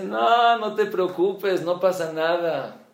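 high, loud, warm-hearted "no, no worries"; loud eager reassurance. Retold or imitated. Divorced man on the phone forgiving his host, loud and eager. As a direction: wave the apology away with a bright shout.

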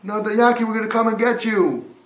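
loud high call down to reassure; rescuer's shout. Retold or imitated. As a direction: yell down a promise of rescue. Rescuer calling down pit.

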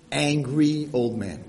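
normal talking voice

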